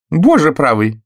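high, loud startled cry; old lady suddenly spotting her win. A narrator's reading. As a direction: burst out in surprise. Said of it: "вдруг вскричала мисс Ганнет".

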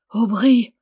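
ordinary delivery

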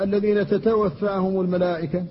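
normal talking voice

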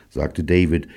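ordinary delivery

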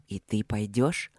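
low, slow searching question; perceptive. A narrator's reading. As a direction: probe her quietly. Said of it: "снова проницательно спросил Кембридж".

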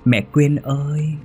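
put-on high-pitched ghost child, words drawn out; bé Na lanh lảnh. A narrator's reading. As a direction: pipe it high and stretch every ending. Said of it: "bé Na cất giọng lanh lảnh". (giọng trẻ con lanh lảnh, ngân dài)

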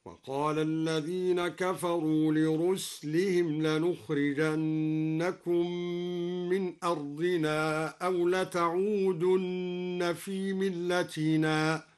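slow tajweed chant with لرسلهم read ruslihim, sin silent; Quranic recitation. Shown as a demonstration. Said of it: "وسكن السين من قوله تعالى".

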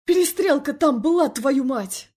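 loud, high exasperated shout; angry yell. A narrator's reading. Felix yelling at his brother's wife, angry. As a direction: yell it right at her. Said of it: "заорал на меня Феликс".

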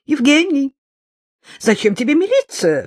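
high, loud alarmed cry; shrill cry of an old woman; cried out. A narrator's reading. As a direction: shriek his name in alarm. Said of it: "вскричала Светлана Фёдоровна".

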